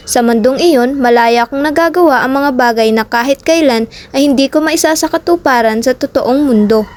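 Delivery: usual speaking voice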